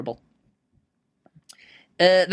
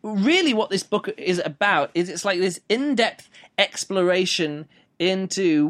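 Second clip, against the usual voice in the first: ordinary delivery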